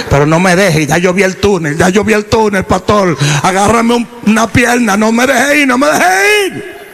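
hammed-up plea that rises to a high wail; desperate pleading. Dying churchgoer begs pastor.

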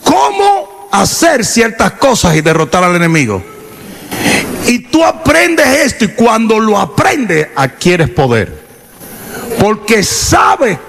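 fiery sermon climax; preaching. Acted. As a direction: build it up like a fired-up preacher. Preaching at full force.

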